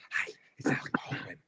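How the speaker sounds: low, fast, whispery Baldwin voice; Alec Baldwin impression. Host doing Kyle Dunnigan doing Alec Baldwin, low and quick. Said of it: "I doing Kyle Dunnigan doing Alec Baldwin".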